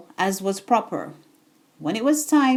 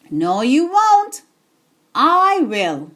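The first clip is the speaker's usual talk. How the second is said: louder, slow and firm hen; firm refusal; 3 semitones higher, 5 dB louder, slower than usual. Acted. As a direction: put the lazy animals in their place. Hen refuses the lazy animals.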